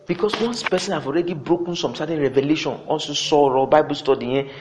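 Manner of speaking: ordinary delivery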